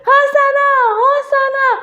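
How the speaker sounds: very high, loud cheers; high ringing call, twice over; demonstrated shout. Shown as a demonstration. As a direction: urge the children to shout along. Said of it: "teriak lagi".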